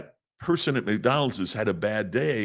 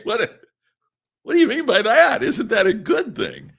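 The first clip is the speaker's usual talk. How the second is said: very high, louder mock-indignant squeak; bewildered protest; 7 dB louder than usual. Student questioning his dismissive speech coach, high bewildered protest. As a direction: play up the baffled student, pitched way up. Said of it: "I'm like".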